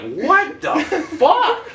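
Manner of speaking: high, broken-up astonishment, pause before FUCK; retold stunned disbelief. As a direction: imitate a whole dorm's shock. Dorm reacts in shock.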